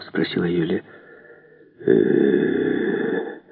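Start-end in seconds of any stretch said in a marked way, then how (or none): 1.9-3.4 low, soft, stretched-out hum; drawn-out hesitation. A narrator's reading. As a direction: drag it out low and quietly. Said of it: "протянул в ответ лейб-егерь". (низко и протяжно)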